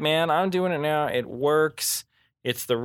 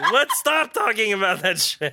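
laughing; 8 semitones higher, 3 dB louder than usual. Retold or imitated. Mocking his own impatience.

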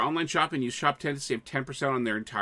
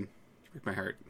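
much softer hammed-up sigh; soft mock heartbreak; 6 semitones lower, 12 dB quieter than usual. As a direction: drop to a wounded murmur. Repeats heartbreak line softly.

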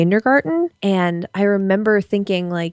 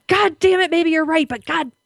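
mock angry outburst; 7 semitones higher than usual. Retold angry outburst at husband.

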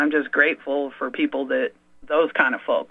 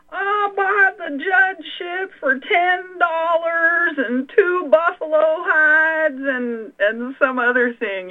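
put-on very high, slow old-timer voice; Cookie; 14 semitones higher, slower than usual. Cookie bragging he bought the judgeship, in a very high, slow voice. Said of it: "the fellow named Cookie, and he's all".

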